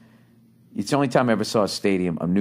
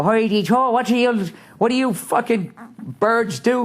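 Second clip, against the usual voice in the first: hammed-up Scottish lad, much higher and louder; mock Scottish accent; 12 semitones higher, 3 dB louder than usual. Mocking how a Scot chats up women, in a hammed-up Scottish accent. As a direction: put on a jokey Scottish brogue, drawn out at the end. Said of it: "however the fuck you guys talk".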